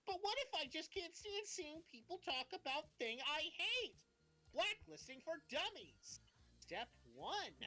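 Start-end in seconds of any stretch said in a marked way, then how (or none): 0.1-6.2 mock whiny question. Mock worried newbie question.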